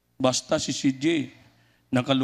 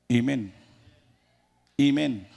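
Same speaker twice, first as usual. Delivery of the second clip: ordinary delivery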